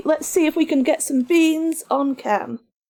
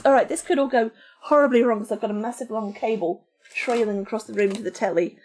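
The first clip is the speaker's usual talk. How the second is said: usual speaking voice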